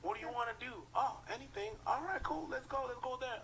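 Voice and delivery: mimicking indecisive date. Voices indecisive date partner.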